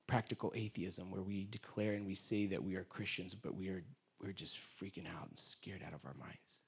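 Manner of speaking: soft, low, intimate praying; quiet prayer. Acted. Pastor praying quietly over the congregation, soft and low. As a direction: pray softly, almost to yourself.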